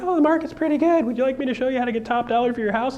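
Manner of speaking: bright high sales pitch, quick; high, fast sales voice; mock salesy pitch. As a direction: pitch it like an eager agent. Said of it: "You're like".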